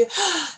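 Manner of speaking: sudden high intake of breath; sharp gasp of realization. Real, not acted. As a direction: gasp as it dawns on you.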